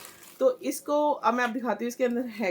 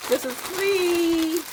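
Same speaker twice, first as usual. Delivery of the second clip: high, drawn-out singsong; playful sing-song; 8 semitones higher, 4 dB louder, much slower than usual. Real, not acted. Vlogger showing viewers the unpaid poly bag, playful sing-song. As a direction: stretch FREE out long and high.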